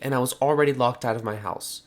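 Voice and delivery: usual speaking voice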